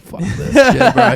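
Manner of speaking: high laughing fake rant; mock rant through laughter. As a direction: toss it off high while laughing. Acted quote, laughing high voice.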